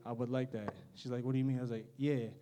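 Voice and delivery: ordinary talk, nothing marked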